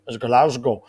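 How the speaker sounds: "Glasgow" with a Glasgow accent, the vowel opened to "lazgol"; Glasgow accent attempt. Shown as a demonstration. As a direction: try to say "Glasgow" the Glasgow way. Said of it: "I can't even say it with a Glasgow accent".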